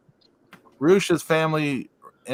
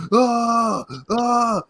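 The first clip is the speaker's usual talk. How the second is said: high, louder mock yelps; mock running yell; 11 semitones higher, 4 dB louder than usual. Little wrestlers sprinting to the ring, high mock yelps. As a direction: yelp like tiny wrestlers sprinting. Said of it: "they're making noises like".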